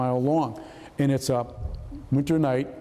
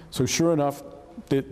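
normal talking voice

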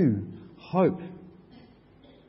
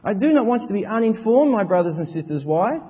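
higher, louder preaching, slowing on the questions; full-force preaching; 7 semitones higher, 5 dB louder than usual. Acted. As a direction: preach with rising urgency.

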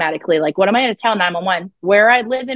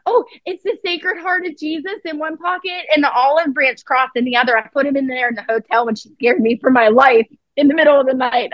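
frightened sister; 6 semitones higher than usual. Retold or imitated. Sister voiced in exorcism story.

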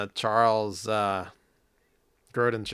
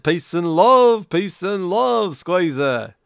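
higher, slow Ringo voice; [name] impression; 6 semitones higher, 4 dB louder, slower than usual. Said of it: "You gotta do Ringo on a much higher register".

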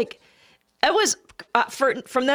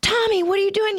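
put-on high-pitched mother calling to her child; retold motherly alarm; alarmed mom; 10 semitones higher than usual. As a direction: imitate a mom flying upstairs, voice pitched up.

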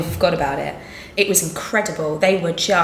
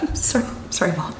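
whispering; 5 dB quieter, faster than usual. Retold or imitated. Shocked on hearing the news.

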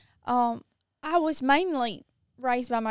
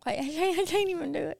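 normal talking voice

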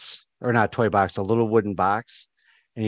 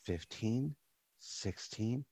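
very soft, half to himself; counting aloud quietly; 15 dB quieter, much slower than usual. Acted. Barkeep counting sheep to himself, very quietly. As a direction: count softly under your breath. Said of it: "you hear him, like".

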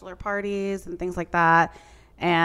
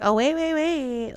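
mock sudden interest; 10 semitones higher than usual. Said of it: "And now she's like".

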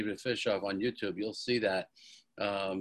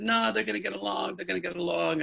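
retold insistent protest, higher pitch; arguing back; 8 semitones higher than usual. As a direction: mildly argue back, voice lifted high. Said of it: "argue with everybody".

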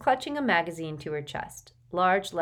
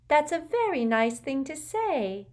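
beaming; 10 semitones higher, 3 dB louder than usual. A narrator's reading. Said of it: "beamed Luna".

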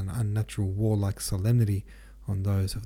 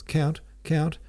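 higher-pitched call, only mildly shouted; 7 semitones higher than usual. A narrator's reading. Wounded officer hailing the Count, mild narrated shout. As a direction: call for the Count's attention, raised but restrained. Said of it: "shouted Berg".

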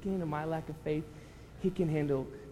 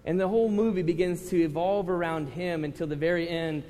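usual speaking voice

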